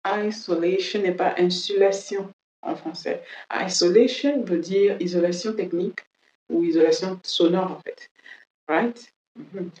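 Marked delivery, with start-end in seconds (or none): none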